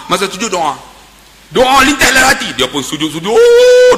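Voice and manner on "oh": a sudden very high, loud wail. Retold or imitated. The old man wails aloud in prostration, a very high cry. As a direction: let out a wailing cry. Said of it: "dia teriak sungguh".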